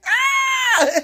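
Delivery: sudden very high shriek; high scream. Retold or imitated. Storyteller ending the scare with a high laughing scream. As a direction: let out a short high scream.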